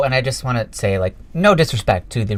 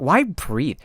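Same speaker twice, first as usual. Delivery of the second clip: usual speaking voice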